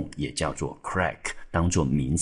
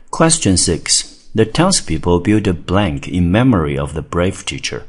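loud, measured English test reading; exam question read-aloud; 6 dB louder than usual. Acted. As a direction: read the question out slowly and loudly.

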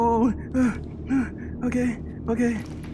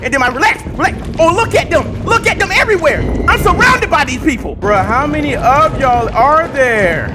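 rising panic; 7 semitones higher, 5 dB louder than usual. Real, not acted. Building panic at many enemies.

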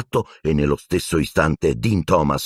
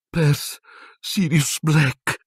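high, slow weak frightened voice; weakly; 10 semitones higher, slower than usual. Acted. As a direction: barely get the words out. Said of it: "disse Ron debolmente".

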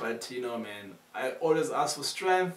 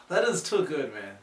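usual speaking voice

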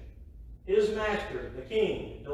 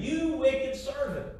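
a stern king's rebuke, slow and higher; 7 semitones higher, slower than usual. Acted. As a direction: scold the servant like an angry king. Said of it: "said to him".

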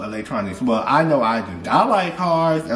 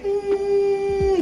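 imitated ringing hum. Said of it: "it makes that".

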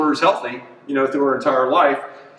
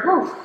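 heavy exhale. Real, not acted. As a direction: blow out hard, out of breath. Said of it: "we can hear [name] like a freight train here".

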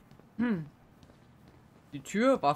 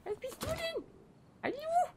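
high squeaky voice; 5 dB quieter than usual. Pretend. Talking to creepy game mascot.

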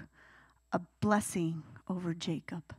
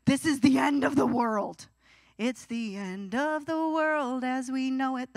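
put-on melodramatic teen; 3 semitones higher, slower than usual. Mocking teenage heartbreak drama.

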